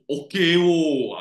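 slow, loud Malaysian "wo" slang; Malaysian slang demo. Shown as a demonstration. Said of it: "jangan pakai benda-benda macam tu".

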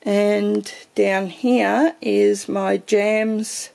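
usual speaking voice

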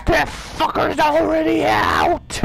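drawn-out high yell; real alarmed shout; panicked shout. Real, not acted. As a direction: shout it in horror as he breaks loose.

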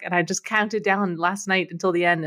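ordinary talk

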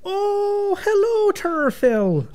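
high, sing-song Irish greeting; exaggerated Irish character hello; Irish accent. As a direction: greet them in a put-on high Irish lilt. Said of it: "he has an Irish accent".

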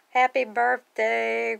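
high, loud, drawn-out greeting; slow, celebratory high call; birthday cheer. Real, not acted. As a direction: stretch it out like a party singalong. Birthday greeting for Grogu's party picture.

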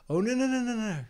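lightly voiced aunt stopping the children turning back; imitating the aunt. Aunt stops kids turning back on the walk, high mock protest. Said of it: "she would always say".

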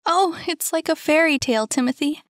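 high, fast, enchanted gush; excited and swept away; Saphira, breathless delight. A narrator's reading. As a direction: rush it out in high-pitched wonder.

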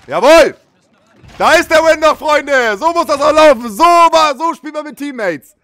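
high, loud triumphant yelling; victory shout. Real, not acted. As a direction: bellow the victory. Celebrating the final win.

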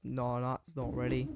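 ordinary delivery

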